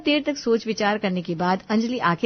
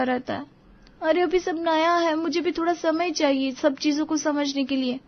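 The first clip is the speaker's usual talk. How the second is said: high-pitched aggrieved wife; upset, angry protest; 8 semitones higher than usual. A narrator's reading. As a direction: defend herself, hurt and indignant, without shouting. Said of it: "Anjali गुस्सा होकर". (आहत पत्नी का ऊँचे सुर में नाराज़ विरोध)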